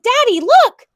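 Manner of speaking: put-on high, loud eager child call; little girl calling her father; excited call. A narrator's reading. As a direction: call out to Daddy, thrilled.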